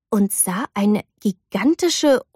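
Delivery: ordinary delivery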